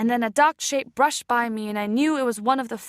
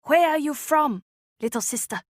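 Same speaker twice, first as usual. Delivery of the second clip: gentler voice; 4 semitones higher than usual. Acted. Boat woman gently asks the lost girl, tender. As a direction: coax the frightened child gently. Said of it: "in a gentler voice".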